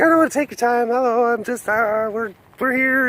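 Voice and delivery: high-pitched imitation of a flustered fan. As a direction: stammer apologetically in a high mock-fan voice.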